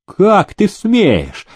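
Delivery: high, drawn-out indignant cry; mild narrated squeal of outrage; outraged shriek. A narrator's reading. Nobleman challenged by Demidov, outraged shriek. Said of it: "истошно взвизгнул проезжий".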